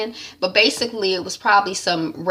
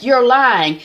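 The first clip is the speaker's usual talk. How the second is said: louder, higher accusation; accusing; 6 semitones higher, 7 dB louder than usual. Retold or imitated. Daniel accusing Nick, louder and higher. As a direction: snap the accusation out. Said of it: "he's like".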